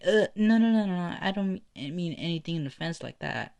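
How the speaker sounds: high-pitched, flustered backpedaling; acted panicky protest, pitched high; flustered denial. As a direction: hurry to take back any offense. Uraka hastily backs off from offence.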